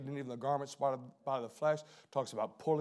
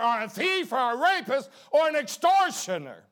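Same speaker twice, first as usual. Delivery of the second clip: higher and louder, preaching hard; full-force preaching; 9 semitones higher, 6 dB louder than usual. Acted. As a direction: build to a fervent pulpit cry. Sermon rises to full preaching.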